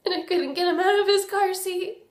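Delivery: crying through the words; tragic heroine sorrow. Real, not acted. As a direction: voice breaking into tears, high and strained. Confessing her little brother drowned.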